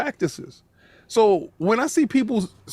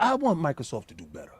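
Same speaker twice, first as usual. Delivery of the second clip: mock sincere quote; 6 semitones lower, 7 dB quieter, faster than usual. Mocking rivals' fake concern.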